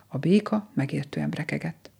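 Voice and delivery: ordinary delivery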